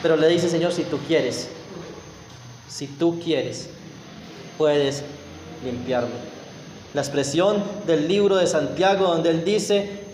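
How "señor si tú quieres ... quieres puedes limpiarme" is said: ruego humilde. Retold or imitated. Oración humilde del leproso, suave.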